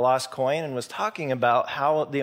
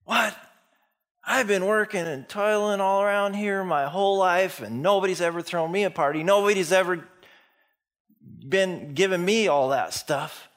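higher, indignant complaint, hammed up; throwing a fit; 6 semitones higher than usual. Older brother resenting the party, indignant fit. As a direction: protest like a jealous older brother. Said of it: "He throws a fit".

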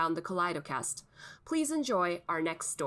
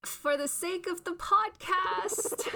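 reluctant; 13 semitones higher, slower than usual. Acted. Co-host forced to taste dish.

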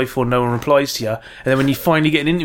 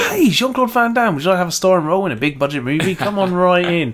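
mock host invitation; 5 semitones higher than usual. Mock inviting Van Damme.

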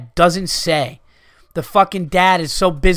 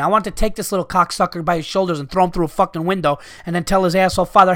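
ordinary delivery

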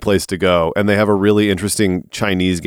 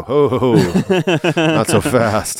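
slow, hammy evil chuckle; slow mock villain laugh; 3 semitones higher, much slower than usual. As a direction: drag it out like a cartoon villain. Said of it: "I just go".

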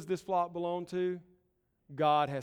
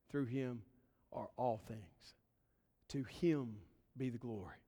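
softer, slowing closing blessing; solemn benediction; 2 semitones lower, 4 dB quieter than usual. Acted. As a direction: hand the words down gently like a final prayer.

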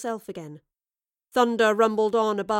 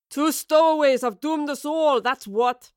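higher, louder rough shout of a sailor; angry sailor shout; 5 semitones higher, 7 dB louder than usual. A narrator's reading. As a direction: bellow the accusation at the strangers. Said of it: "one of the sailors shouted in reply".

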